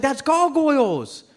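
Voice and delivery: high, drawn-out insistence; excited insistence. Acted. Comic corrects doubting roommate about cartoon, excited insistence. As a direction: insist on it, pitched right up.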